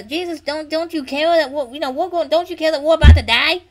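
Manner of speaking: acted-out angry demand; mad, accusing. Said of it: "they were mad at him".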